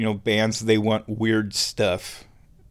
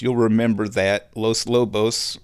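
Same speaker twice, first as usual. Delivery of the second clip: ordinary delivery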